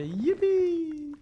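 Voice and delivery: very high, loud yippee; excited cheer. Acted. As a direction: squeal it with joy. Cheering about warm winter.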